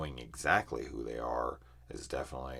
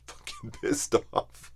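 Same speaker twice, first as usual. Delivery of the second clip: amused admission through a laugh; real chuckle breaking into the words; 4 dB louder, slower than usual. Real, not acted. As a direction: laugh while owning up to being annoyed. Laughs at own anger.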